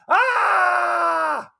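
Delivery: high, loud drawn-out victim's scream; acted scream. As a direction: scream out as if in sudden pain.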